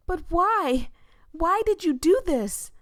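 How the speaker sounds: high, loud pleading cry; anguished shout. Acted. Voya shouting at her boyfriend over the murder. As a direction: demand an answer in anguish.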